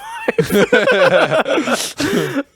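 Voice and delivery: high bursting laugh; embarrassed laugh at own story; big burst of laughter. Real, not acted. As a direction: laugh out loud, high and free. Laughing about winning a PS5.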